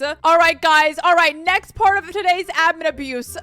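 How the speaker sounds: normal talking voice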